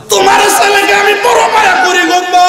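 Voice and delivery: high chanted shout, last word held; impassioned cry. Retold or imitated. Prophet pleading with the mother for her son, high chanted cry. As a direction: plead at full voice in preacher's chant. Same words cried out to the mother.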